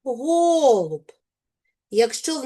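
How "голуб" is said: "Голуб" with a long, high, stressed о; "Голуб", first syllable raised and drawn out. Shown as a demonstration. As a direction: stretch the о in "Голуб" to show the stress. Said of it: "щоб ненаголошений звук став наголошеним".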